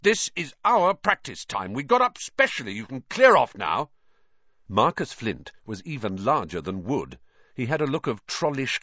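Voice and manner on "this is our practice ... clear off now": high-pitched, indignant tirade; raised voice, outraged and insistent; bellowing. A narrator's reading. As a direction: order them off angrily. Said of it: "Wood bellowed at the Slytherin captain".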